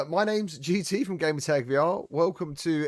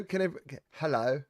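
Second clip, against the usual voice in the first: ordinary talk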